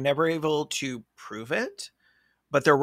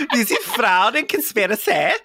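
laughing through a higher, proud introduction; laughing mock introduction; 5 semitones higher, 4 dB louder than usual. Mother joking about baby names, laughing mock pride. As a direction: giggle as you proudly present.

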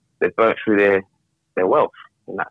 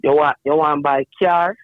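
higher, lightly imitated Jamaican accent; 7 semitones higher, 4 dB louder than usual. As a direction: quote granddad in a Jamaican accent. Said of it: "in his thick Jamaican accent".

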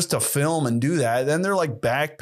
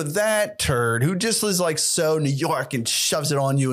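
usual speaking voice

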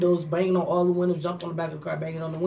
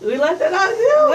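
much higher, louder, laughing tease; teasing laugh; 9 dB louder than usual. Real, not acted. Outing her friend's side chick, high laughing tease. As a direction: needle her while cracking up.

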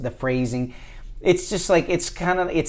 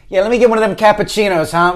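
loud, fast, higher New Yorker order; New York accent; 8 semitones higher, 9 dB louder, much faster than usual. Retold or imitated. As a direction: rattle it off like a brash New Yorker. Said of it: "I could hear myself going".

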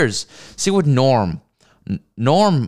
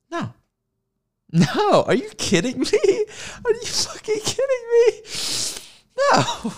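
much higher, wailing mock cry; mock sobbing. Mocking girl crying over dead snake, wailing. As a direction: wail it like a heartbroken girl.

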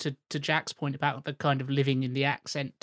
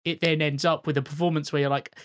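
ordinary talk, nothing marked

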